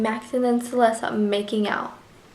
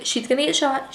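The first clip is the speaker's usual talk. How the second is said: normal talking voice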